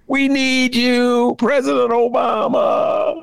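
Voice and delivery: slow, high call to Obama. Real, not acted. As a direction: stretch it out, calling to him from afar. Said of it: "calls out to Obama".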